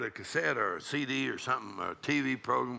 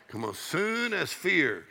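ordinary delivery

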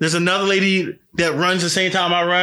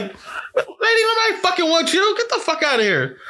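high, scoffing brush-off with a laugh in it; mocking retort; 12 semitones higher than usual. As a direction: shoo the lady off with a mocking, pitched-up sneer. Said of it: "It's like".

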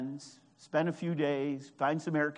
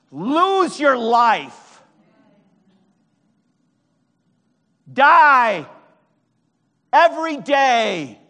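very loud, high, drawn-out preacher's cry; preaching at full force; 9 semitones higher, 13 dB louder, much slower than usual. Real, not acted. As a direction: shout each phrase as a commanding exhortation.

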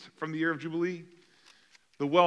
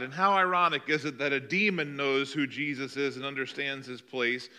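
ordinary talk, nothing marked

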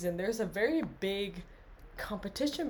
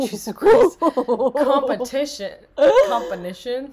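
exasperated outburst; 6 semitones higher, 14 dB louder than usual. Real, not acted. Mock-dramatic outburst, loud laugh.

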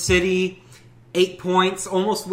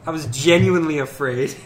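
laughing; 2 semitones higher, 8 dB louder than usual. Real, not acted. Laughing retelling of fear.

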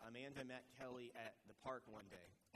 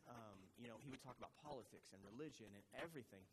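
ordinary delivery

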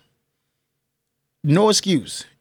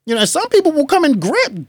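usual speaking voice

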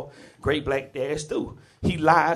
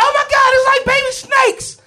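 very high, loud horrified shriek; panicked mom shriek; 11 dB louder than usual. Retold or imitated. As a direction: scream like you just saw snakes. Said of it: "she's like".

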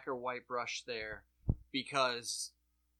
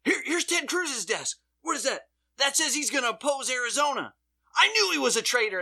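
much higher and louder, breathless discovery; hammed-up dumb rioter, faster at the end; idiot rioter; 10 semitones higher, 7 dB louder than usual. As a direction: gloat over the find like a dimwit. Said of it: "one idiot's like".

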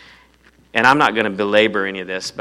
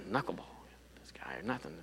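whisper; 2 semitones lower, 18 dB quieter than usual. Acted. Trailing joke about armor.

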